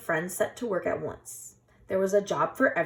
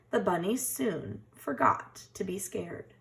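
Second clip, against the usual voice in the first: ordinary delivery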